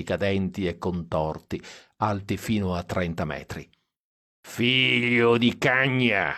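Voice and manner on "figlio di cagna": put-on drunk shout. A narrator's reading. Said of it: "urlò Stan dietro".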